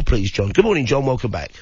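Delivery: ordinary talk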